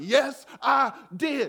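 slow, high, loud and triumphant; a gloating, drawn-out yes I did; proud reply. Retold or imitated. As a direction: savor each word like a victory. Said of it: "I was like".

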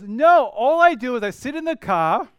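emphatic retold explanation, high and loud; car salesman friend. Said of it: "And he goes".

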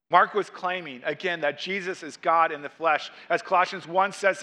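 normal talking voice

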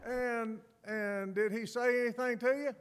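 lightly voiced puzzled wife, high; a hint of wife. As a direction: play the curious wife, mildly. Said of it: "she said".

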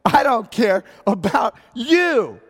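loud, high, drawn-out retort; re-enacted excited shout; worked-up yell. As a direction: fire it back at full voice, slowly. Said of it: "I'm getting excited right now".

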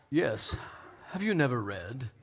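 soft, low, dramatic scripture voice; half-hearted theatrical Jesus, low and soft; best Jesus voice. Acted. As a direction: put on a hushed, solemn British-style holy voice. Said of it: "in my best Jesus voice".

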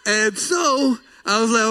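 ordinary delivery

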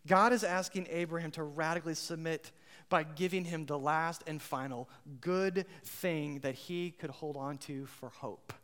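ordinary delivery

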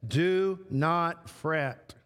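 "Do not fret" drawn out very slowly, word by word; slow, deliberate repetition. Shown as a demonstration. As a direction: let "Do not fret" sink in slowly, each word weighted. Repeats the phrase with weight.

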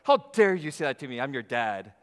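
loud, offended how-dare-you outburst; indignant dad. Retold or imitated. Dad snapping back at his family who called him out, loud and indignant. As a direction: bristle at being called out by family. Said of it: "you're like".

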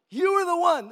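high, loud accusation; accusing servant girl. Acted.

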